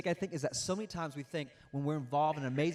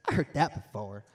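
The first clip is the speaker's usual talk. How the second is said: unimpressed aside; 6 semitones lower than usual. Pretend. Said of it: "Look at the person next to you and be like".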